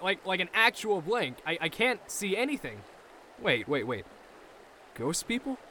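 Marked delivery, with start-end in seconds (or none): 3.4-5.6 eerie low ghost voice; ghostly voice. Acted. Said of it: "ghostly voice".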